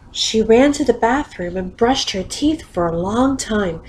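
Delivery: plain reading voice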